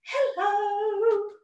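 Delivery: a light, high fairy hello; high sweet fairy greeting. Acted. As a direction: greet the class as a tiny fairy.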